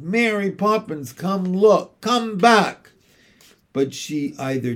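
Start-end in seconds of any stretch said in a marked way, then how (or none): 0.0-2.9 higher pleading call. Acted. The children calling Mary Poppins back, high. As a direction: beg her to come back. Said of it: "they cried".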